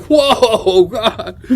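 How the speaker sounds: high, loud, taken aback; re-enacted startled reaction. Driver gets cursed at by Mike, re-enacted shock. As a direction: recoil from the outburst, half laughing.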